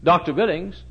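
ordinary delivery

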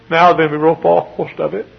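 high, loud, overwrought voice; acted anguish, pitched high; imitated tearful lament. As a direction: cry it out as the sobbing custodian. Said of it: "He began to sob".

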